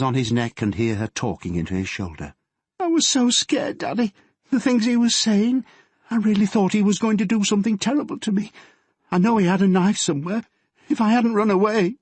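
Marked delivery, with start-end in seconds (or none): 2.8-12.0 high, trembling, breaking into tears; terrified daughter clinging to her dad; frightened sobbing girl. Acted. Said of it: "She collapsed into sobs".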